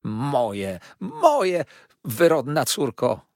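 high, loud, slow wail of outrage; outraged cry. A narrator's reading. Mother wails at her defiant daughter. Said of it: "wołała pani Markleham".

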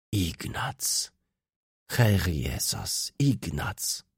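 slow, understated cry of amazement; astonished grandmother. A narrator's reading. Grandmother embraces long-lost Ignaz, softly astonished. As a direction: draw it out, amazed, without raising the voice. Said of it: "zawołała, ściskając mnie".

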